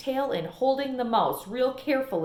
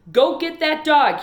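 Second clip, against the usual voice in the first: loud, higher yelled command; yelled order; 6 semitones higher, 9 dB louder than usual. Acted. Preacher yelling at Opal to fetch the dog. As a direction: yell it across the church. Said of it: "he yelled".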